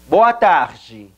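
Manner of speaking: BOA TARDE as "BOH-ah TAH-jee"; breathed r and "jee" ending in BOA TARDE; Portuguese pronunciation. Shown as a demonstration. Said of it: "Pasemos entonces al ejercicio del backtracking".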